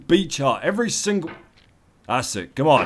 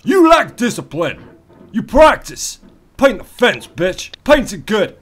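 higher and louder barking orders, last line slow; drill sergeant; 9 semitones higher, 7 dB louder than usual. Acted. Gamer playing drill sergeant at his climber, higher and louder. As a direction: bark it like a training montage coach.

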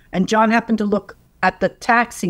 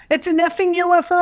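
high, excited half-scream of the news; mock scream; 11 semitones higher than usual. Yelling to John on the roof about the UFO, excited. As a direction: shout it out in disbelief, pitched way up. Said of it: "I'm screaming".

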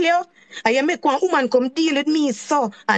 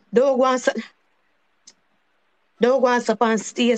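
ordinary delivery

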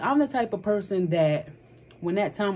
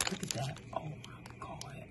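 whisper; 2 semitones lower, 18 dB quieter than usual. Real, not acted. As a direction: breathe it out in awe. Reacting to the moist brownie.

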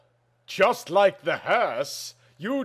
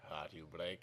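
much lower, barely audible echo; quiet echo; 11 semitones lower, 22 dB quieter than usual. Acted. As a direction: fade it out as an echo. Echo of the line before.

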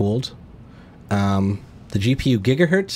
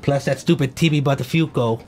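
goat voice; 5 semitones higher than usual. Acted. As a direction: play the goat, big and hammy. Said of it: "in the goat voice".

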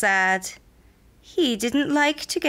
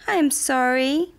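high, small apology; apologetic frog; 6 semitones higher than usual. A narrator's reading. Tiny frog says sorry to the bee, high and pleading.

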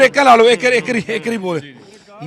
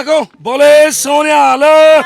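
loud, drawn-out rallying shout, pitched higher; jaikara call; 4 semitones higher, 15 dB louder, slower than usual. Real, not acted. Sikh volunteer leading the family in a jaikara, full shout. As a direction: belt the call so the crowd answers.

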